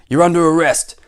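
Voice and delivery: forceful storybook soldier; stern soldier. Acted. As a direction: announce it like a guard making an arrest. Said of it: "said the soldier".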